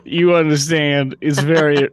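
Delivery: loud, hammed-up Southern accent; infectious Southern drawl. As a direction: play up a thick Southern accent, loud and slow. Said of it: "It's infectious".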